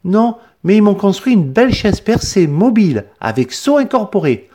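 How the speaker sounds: voix d'enfant bien plus aiguée; un petit garçon poli qui répond. A narrator's reading. Benoît répond. (enfant à la voix très aiguë)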